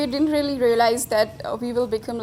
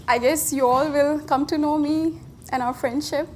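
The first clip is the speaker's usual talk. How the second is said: ordinary talk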